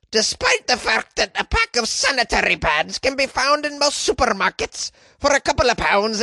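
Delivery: high, badly faked Scottish female voice; Scottish woman's accent. Host quoting Monica Lennon, badly faked female Scottish accent. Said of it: "Scottish accent that's also in a female voice".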